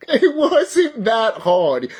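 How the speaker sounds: slow, laughing through the words. Real, not acted. Vlogger laughing at his own rant, slow and giggly. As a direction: let the laugh spill over each word.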